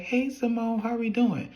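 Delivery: high-pitched, sing-out hello; put-on high greeting on the phone; chirpy phone greeting. Acted phone greeting to Simone.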